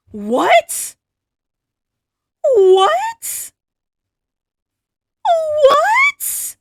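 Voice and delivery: very high, loud squeals of disbelief; freaked-out shriek. Real, not acted. Stunned shopper seeing the watch price, freaked-out shrieking. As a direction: screech it in total shock. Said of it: "I'm freaking out my cats".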